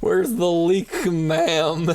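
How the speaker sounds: high, slow put-on voice; slow, high joke voice; mock official voice. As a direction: ask it like a comic inspector, drawn out. Joking put-on voice.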